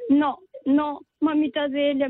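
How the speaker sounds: a hint of a tearful little girl saying no; mild imitation of a child begging grandma; little girl refusing. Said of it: "me dice".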